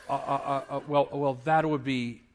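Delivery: stuttering uhs and wells; reluctant stammer. Acted. Esther about to name Haman to the king, haltingly. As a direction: stall before naming the culprit.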